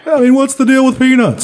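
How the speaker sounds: loud, high setup with a laugh; eighties stand-up comic. Pretend. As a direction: deliver it like a cheesy club comic. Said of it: "eighties stand-up comedian".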